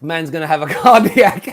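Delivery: laughing through the words; giggling at his own overreaction. Real, not acted. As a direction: chuckle it out in disbelief.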